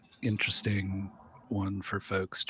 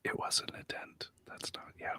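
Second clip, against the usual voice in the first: soft unvoiced whisper; 16 dB quieter than usual. Real, not acted. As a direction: drop to a whisper. Whispering a touchy topic aside.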